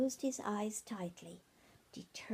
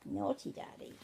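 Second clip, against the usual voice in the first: ordinary delivery, nothing marked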